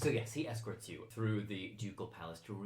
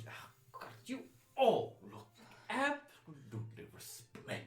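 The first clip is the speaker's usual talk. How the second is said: higher-pitched, gushing aristocrat; effusive courtier; 6 semitones higher than usual. Acted. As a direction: admire their finery as a delighted noble. Game master plays admiring courtier.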